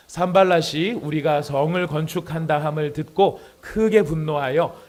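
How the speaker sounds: loud, slow, measured Bible reading; scripture reading. Acted. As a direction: read the passage out strongly for a congregation. Reads Bible passage aloud. (크고 느리게 또박또박 성경 봉독)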